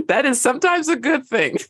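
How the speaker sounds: laughing through the words. Real, not acted. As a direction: repeat it while laughing. Repeats joke while laughing.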